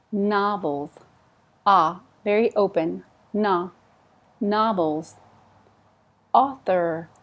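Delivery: normal talking voice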